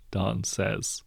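ordinary delivery

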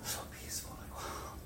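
soft whispered wonder; floating away, awed whisper. Retold or imitated. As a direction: breathe it out in peaceful awe. Out of body bliss.

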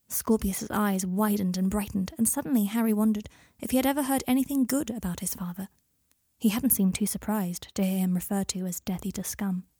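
ordinary delivery

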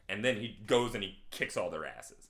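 ordinary talk, nothing marked

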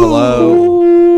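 high, loud squeaky hello; squeaky greeting voice. Acted. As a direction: chirp it in a cartoon voice. Greeting in a put-on voice.

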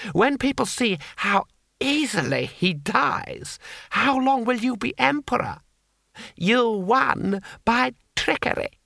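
high, slow, gloating noble voice; Lord Hong. A narrator's reading. Lord Hong gloating to Cohen over the hostage, slow and high. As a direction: drag the words out, enjoying the threat.

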